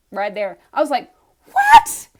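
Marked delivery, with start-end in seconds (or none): 1.5-2.1 very high, loud squeal of disbelief; shocked shriek. Retold or imitated. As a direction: shriek it in stunned amazement. Retold shock at unexpected message.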